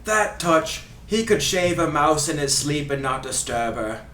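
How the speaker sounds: put-on Ray Hudson Scottish accent. Host reading a free kick quote, in fake Scottish accent. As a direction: fake Scottish commentator, theatrical. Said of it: "doing his accent".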